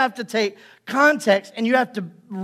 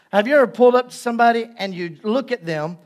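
ordinary delivery

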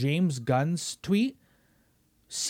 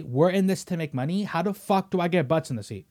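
ordinary talk, nothing marked

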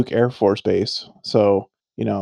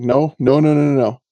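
retold urgent refusal; 4 semitones higher, 6 dB louder than usual. Adults urgently stopping him sleeping.

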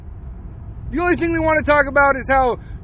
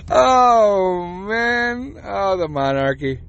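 ordinary delivery

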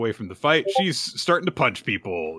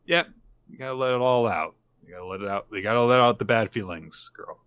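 normal talking voice

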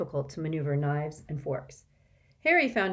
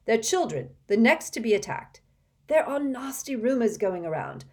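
higher, steady voice of a worried father; 6 semitones higher, 5 dB louder than usual. Acted. Mr Weasley tells the children about the rumours, grave. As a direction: explain the grim rumours gravely.

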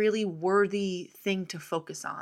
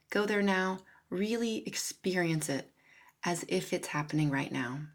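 calm, steady visualization voice; guided visualization. Acted. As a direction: lead them gently into the future.

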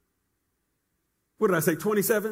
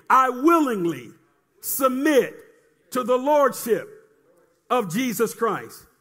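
each phrase set apart by pauses; firm, spaced confession. Shown as a demonstration. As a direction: call it out in pieces for repeating. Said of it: "Say it again".

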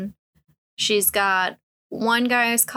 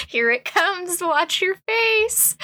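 much higher, drawn-out callout; mock excited warning; 10 semitones higher, slower than usual. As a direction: stretch out a teasing heads-up in a high voice. Character warns in acted comic quote.

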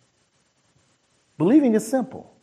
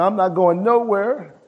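mildly petulant refusal, pitched up; mock whine; 5 semitones higher than usual. Father refusing to leave Jesus, mildly whining. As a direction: dig in with a light whine. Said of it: "stayed there and whined".

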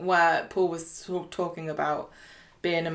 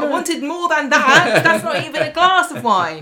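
laughing complaint; 8 semitones higher, 7 dB louder than usual. Retold or imitated. Leo moaning at Nick over tiny pour, laughing whine. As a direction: whine about the thimble of wine, cracking up.

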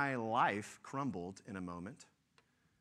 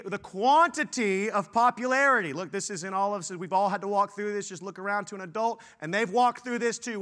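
loud, high, driving sermon voice; rising pulpit intensity, loud; full-force preaching; 6 semitones higher, 9 dB louder than usual. Acted. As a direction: preach it hard to the students.

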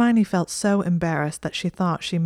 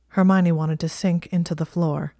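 ordinary delivery, nothing marked

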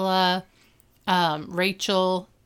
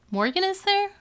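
surprised question; 11 semitones higher than usual. Real, not acted. Surprised at unexpected cast member.

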